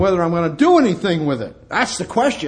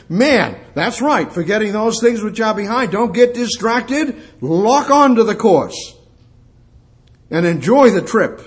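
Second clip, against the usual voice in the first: rising exhortation; 7 semitones higher, 6 dB louder than usual. Acted. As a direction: drive it home to the flock. Preacher urging congregation forward.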